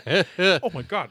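slow, high laughing exclamation. Real, not acted. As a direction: laugh it out in disbelief. Laughing at the word.

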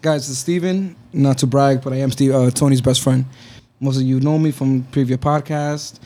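normal talking voice

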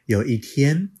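plain reading voice

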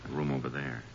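low, soft words so the man won't bolt; hushed. Acted. Ranger warns partner not to spook Charlie, hushed. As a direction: keep it down, creeping closer.

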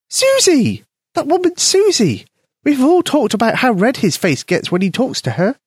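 high, tiny mouse voice; squeaky mouse voice. A narrator's reading. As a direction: voice a small eager mouse. Mouse Polka speaks.

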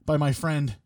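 normal talking voice

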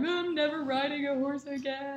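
high-pitched imitation of a crying child; hammed-up tearful whine, without extra volume; mock sobbing wail. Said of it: "am sobbing and screaming".

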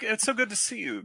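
high-pitched mock Mid-Atlantic accent. Shown as a demonstration. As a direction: greet them in a put-on, old-film Atlantic accent. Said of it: "It's the Atlantic accent".

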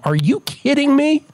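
high, incredulous retold protest; indignant disbelief. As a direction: pitch it way up in mock outrage. Retold protest to airport staff.

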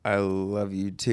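slow, soft sincere reply. Shown as a demonstration. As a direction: say it softly, meaning it. Shows his reply to fans.

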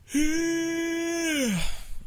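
long high stretched yawn sound; big yawn. Retold or imitated.

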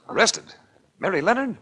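high, loud startled question; shocked. Acted. Detective hearing the girl was arrested, shocked. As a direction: jolt up in disbelief.

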